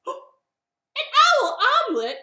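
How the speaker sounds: very high, loud shocked squawk; horrified owl. Acted. Owl learns he's on the menu, horrified cry. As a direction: echo it in loud, hammed-up horror.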